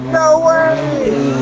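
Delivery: high, amazed no way; excited shout. Real, not acted. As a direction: shout it out in disbelief. Watching aircraft take off.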